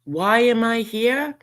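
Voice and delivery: ordinary talk, nothing marked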